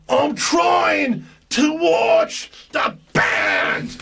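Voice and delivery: high, slow, furious scream; screaming in my face. Retold or imitated. Rock star screaming in a fan's ear. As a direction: scream it right into his ear. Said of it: "And he screams in my face".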